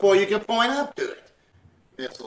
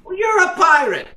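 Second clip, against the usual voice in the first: indignant shout; 12 semitones higher, 9 dB louder than usual. Acted. McGee shouting at the tree seller, indignant yell. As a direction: yell the insult high and loud. Trading insults over the price.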